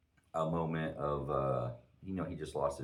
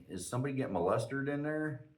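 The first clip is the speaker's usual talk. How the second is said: higher-pitched jokey call-out; joking question; 8 semitones higher than usual. Retold or imitated. To a couple in a bar stall, joking. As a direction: toss it out as a light, teasing question. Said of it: "trying to lighten the mood".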